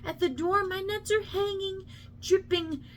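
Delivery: a mother berating her idle son, climbing to a shrill pitch; scolding mother. Acted.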